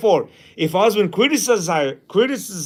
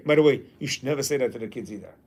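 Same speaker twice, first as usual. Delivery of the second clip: normal talking voice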